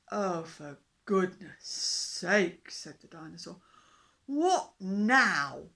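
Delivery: loud, very slow, fed-up beast voice; exasperated dinosaur. A narrator's reading. Dinosaur groans at the fish king, fed up and loud. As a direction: sigh it out like a bored giant.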